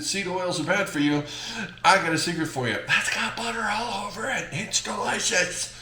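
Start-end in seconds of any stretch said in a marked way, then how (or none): 2.9-5.7 high-pitched silly foodie voice; hammed-up gushing. Cook gushing over a buttery po' boy, hammed-up high voice. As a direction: gush in a high, put-on voice.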